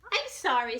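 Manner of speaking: high small bunny voice; little bunny. Acted. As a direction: play a tiny eager bunny.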